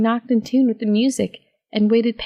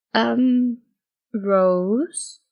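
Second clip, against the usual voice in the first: slow hesitant ask. Acted. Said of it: "she asked slowly".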